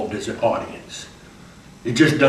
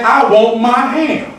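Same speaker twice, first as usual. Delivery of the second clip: higher, louder, slow insistent plea; Hambone demanding ham; 10 semitones higher, 8 dB louder, slower than usual. Acted. Hambone demanding his ham, loud and insistent. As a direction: plead for the ham stubbornly. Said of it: "and say".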